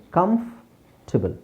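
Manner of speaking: ಕಂಫರ್ಟಬಲ್ slowly split as kamf, tibal; correct pronunciation. Shown as a demonstration. Teacher modelling silent O and R, correct pronunciation. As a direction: pause after kamf in ಕಂಫರ್ಟಬಲ್. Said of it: "ಓ ಮತ್ತು ಆರ್ ಎರಡೂ silent".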